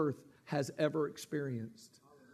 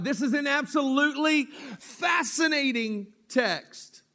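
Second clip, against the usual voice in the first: shouted, high and loud with wonder; fiery preacher shout; excited outburst; 8 semitones higher, 5 dB louder than usual. Real, not acted. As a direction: burst out in amazement at the text.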